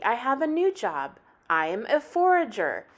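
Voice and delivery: ordinary delivery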